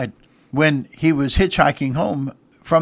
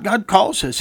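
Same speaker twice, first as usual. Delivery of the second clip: normal talking voice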